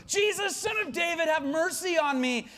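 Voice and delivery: high, insistent shouted plea. Acted. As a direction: yell over the crowd as the blind beggar. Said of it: "he yells all the louder".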